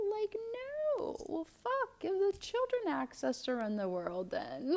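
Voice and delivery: high-pitched incredulous outburst, easing down toward the end; mock exasperation. Tarot reader scoffing at a world tainted by age eight, mock-exasperated. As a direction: squeal it in sarcastic disbelief.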